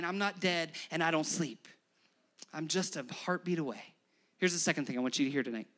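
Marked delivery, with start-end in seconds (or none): 0.0-3.9 lower, softer and gentle; tender God voice. Pretend. God comforting someone in despair, soft and tender. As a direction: reassure the grieving listener quietly. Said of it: "God showing up and saying".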